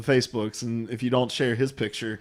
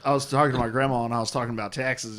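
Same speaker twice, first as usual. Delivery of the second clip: ordinary talk, nothing marked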